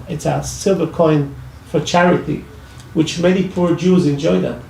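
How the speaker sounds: usual speaking voice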